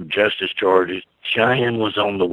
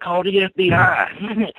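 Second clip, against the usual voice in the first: daughter's cheeky reply; 11 semitones higher than usual. Retold or imitated. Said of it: "And she said".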